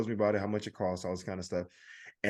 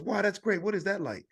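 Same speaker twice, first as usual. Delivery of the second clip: friendly salesman; 9 semitones higher than usual. Retold or imitated. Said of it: "he goes".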